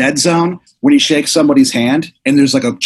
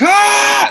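angry yell. Pretend. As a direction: let out a furious kid's Ah. Angry kid yelling.

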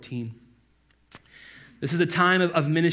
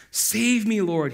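raised-pitch cry for help; narrated shout; 6 semitones higher, 4 dB louder, slower than usual. A narrator's reading. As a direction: shout it moderately, as in storytelling. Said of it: "he shouted".

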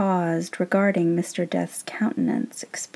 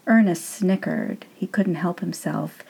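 plain reading voice

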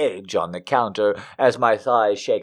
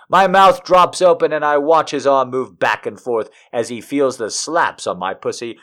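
ordinary delivery, nothing marked

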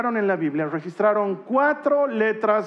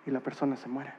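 much softer and lower; hushed and grave; 6 semitones lower, 8 dB quieter, faster than usual. Real, not acted. Preacher to the congregation on dying, hushed and solemn. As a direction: speak of death barely above a breath. Hushed talk about death.

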